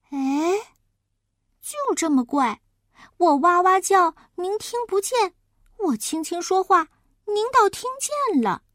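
put-on high-pitched complaining kid; baffled child. Child complains of grandma's odd hearing, high and baffled. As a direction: puzzle aloud like an aggrieved child.

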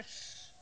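轻轻一声嘘; 学观众示意安静. Retold or imitated. 学后排观众嘘人. (学后排观众嘘了一声)

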